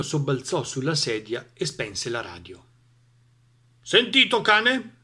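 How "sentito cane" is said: loud, high booming voice; booming farmer. A narrator's reading. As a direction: thunder it out like an old farmer. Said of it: "tuonò". (vecchio contadino che tuona forte)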